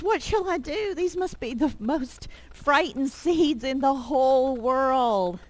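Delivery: high-pitched storybook lament; despairing Toad. Acted. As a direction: lament in hammed-up helplessness. Toad despairs over frightened seeds.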